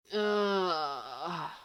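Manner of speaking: a long pained moan. A narrator's reading. Someone in the corner waking sore: drawn-out pained moan. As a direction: groan like waking hurt. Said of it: "someone moans from the corner".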